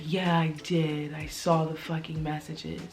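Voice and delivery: cold confrontation. Retold or imitated. Confronting him about texts.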